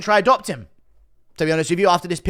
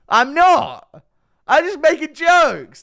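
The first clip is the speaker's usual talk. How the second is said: high, loud self-defence; exasperated protest; 10 semitones higher, 5 dB louder, slower than usual. Real, not acted. Vlogger denying he is a villain, exasperated and high. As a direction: insist on it, stretched out and indignant.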